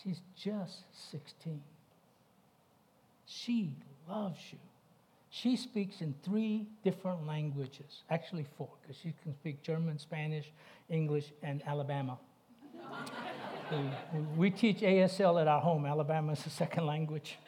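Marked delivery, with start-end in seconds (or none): 0.0-4.6 slow, hushed pleading; father praying over his sick daughter, softly and slowly; grieving prayer. Retold or imitated. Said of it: "and I said". 12.2-17.4 chuckling through a joke; laughing. Real, not acted. Joking about Alabama.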